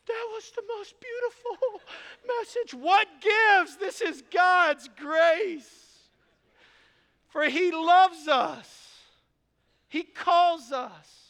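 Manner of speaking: hammed-up sobbing wife gushing over the sermon; tearful wife voice. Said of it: "She's like".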